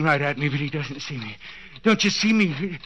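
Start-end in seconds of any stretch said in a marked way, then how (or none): 1.8-2.8 high, pressing urgency; will the child to see you; frantic hope; urgent. Acted. Willing the boy to notice.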